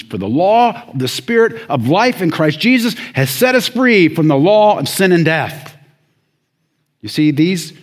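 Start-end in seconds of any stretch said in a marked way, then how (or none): 0.0-5.8 high, loud recitation of scripture; forceful scripture preaching. Real, not acted. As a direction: proclaim the verse to the congregation.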